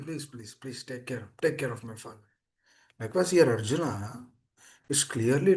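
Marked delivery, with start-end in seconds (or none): 0.0-2.3 hushed, low pleading; praying. Acted. Preacher voicing Prahlad's plea for his father, hushed. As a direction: drop to a very soft, humble plea. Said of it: "he is praying to Lord Nrsimha".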